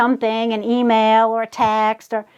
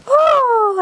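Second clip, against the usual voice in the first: delighted oh; 9 semitones higher than usual. Real, not acted. Crafter seeing the little clock, delighted squeal. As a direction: squeal at how cute it is.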